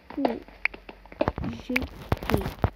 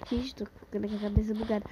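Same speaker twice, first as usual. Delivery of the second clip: whispered laugh; 5 semitones lower, 3 dB quieter, faster than usual. Real, not acted.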